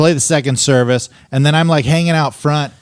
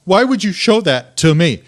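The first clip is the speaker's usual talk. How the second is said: retold indignant question; 5 semitones higher than usual. Wife demanding an explanation from husband, deliberate mock indignation. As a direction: repeat it deliberately, as the appalled wife.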